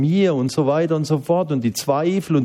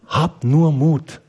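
encouraging; 3 semitones higher, slower than usual. Retold or imitated. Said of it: "sagten zu ihm".

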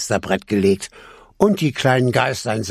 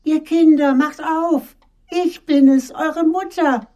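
very high, sweetly coaxing falsetto; wolf posing as gentle mother, calling high; wolf's disguised soft voice; 3 dB louder than usual. A narrator's reading. Wolf coaxes kids after eating chalk, high disguised voice. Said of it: "und rief".

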